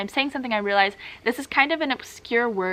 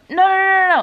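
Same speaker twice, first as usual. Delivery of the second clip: nagging refusal; 9 semitones higher, 9 dB louder than usual. Acted. Teacher stops a student switching back to their own language, loud nagging. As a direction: cut them off with a high, insistent nag. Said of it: "kind of sound like a bit of a nag".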